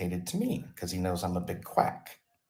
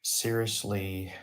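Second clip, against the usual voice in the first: ordinary talk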